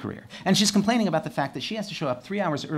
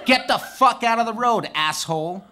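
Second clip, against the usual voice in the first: higher, louder, laughing through it; mock yell; 7 semitones higher, 4 dB louder than usual. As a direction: hurl it like the moped riders. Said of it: "yelled the same thing".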